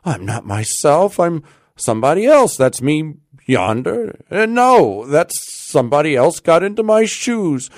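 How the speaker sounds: high, bewildered outpouring; at wit's end. A narrator's reading. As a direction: build to a loud, desperate cry of confusion. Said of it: "at his wit's end".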